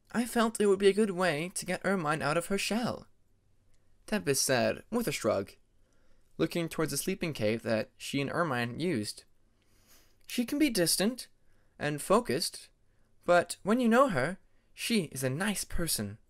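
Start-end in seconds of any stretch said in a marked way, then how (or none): 0.1-3.1 put-on lightly higher, gentle young dragoness voice; Tempest, kindly explaining. Tempest explaining to Peacemaker why she sent Ermine, lightly higher and kind. 10.3-16.2 put-on lightly higher, gentle young dragoness voice; Tempest, kindly explaining. Tempest explaining to Peacemaker why she sent Ermine, lightly higher and kind.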